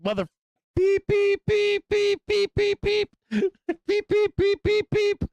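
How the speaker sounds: very high piping beep beep beep, like a bleeped-out curse; playful imitation of a bleeped swear; mock censor bleeps. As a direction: squeak the beeps like a TV censor tone. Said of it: "I'd be like".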